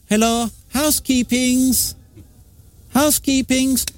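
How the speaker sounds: high put-on maid's voice; imitating the maid. The maid walking in on her, voiced high and sing-song. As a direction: mimic the cleaner announcing herself, high.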